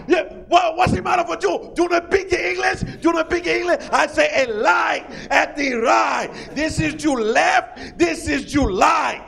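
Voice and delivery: put-on high, slow, shouted Spanish-accented directions; losing patience, spelling out left and right; hammed-up frustrated shout; exasperated Spanish accent. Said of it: "He got excited".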